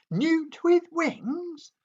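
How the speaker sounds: very high, slow, loud dragon squeal; outraged squeak. A narrator's reading. Toothless repeats the insult, a very high slow indignant squeal. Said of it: "repeated Toothless".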